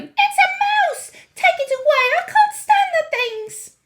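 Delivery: very high, loud panicked shriek; yelling. A narrator's reading. Mrs. Jenkins springs from her chair at a mouse, yelling. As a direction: yell in terror, voice shooting up.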